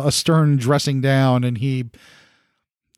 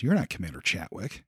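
low suspicious murmur; 9 semitones lower, 11 dB quieter than usual. Acted. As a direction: lower and much softer, suspicious. Soldier's suspicious realisation.

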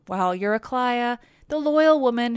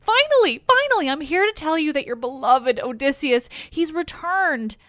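much higher and louder, bursting out; excited rush; 5 semitones higher, 5 dB louder than usual. Acted. Old nurse bursting with news to Penelope, excited. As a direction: pour out the happy news all at once. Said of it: "lets it out in a rush of words".

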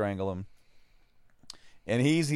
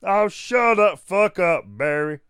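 loud, raised backwoods Southern snap; hammed-up backwater Southern bark; ultra backwater Southern; 8 semitones higher, 10 dB louder, slower than usual. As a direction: play an irritated Southern yokel. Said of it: "I love that you hear him as this, like, ultra backwater".

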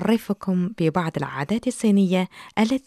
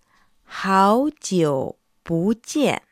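very slow, syllable by syllable; staccato; much slower than usual. Shown as a demonstration. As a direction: clip each syllable apart. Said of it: "وفي اللغة الصينية نقول".

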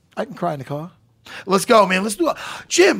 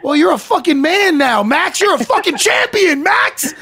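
excited shouting; 11 semitones higher, 4 dB louder, slower than usual. Real, not acted. Hyping up the new champion.

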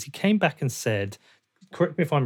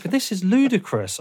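higher, drawn-out outraged protest; mock-outraged, pitched up and slower; retold indignation; 8 semitones higher than usual. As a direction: act out an exasperated parent's objection. Parent retelling own outraged reaction.

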